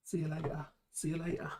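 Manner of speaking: lightly imitated catchphrase; Aaron Kraskell impression. Imitating a fun guy's sign-off, lightly mimicked. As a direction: toss it out as a hint of someone's catchphrase. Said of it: "Reminds me of Aaron Kraskell".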